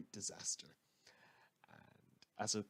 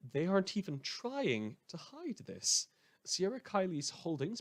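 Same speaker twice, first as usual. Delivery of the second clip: indignant outburst; 8 semitones higher, 5 dB louder than usual. Acted. Host's outraged aside to listeners.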